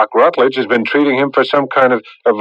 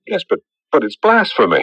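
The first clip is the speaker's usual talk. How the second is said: agitated protest; 8 semitones higher than usual. Acted. Agitated, told to calm down.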